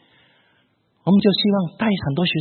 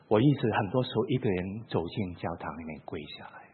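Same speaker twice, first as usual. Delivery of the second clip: ordinary talk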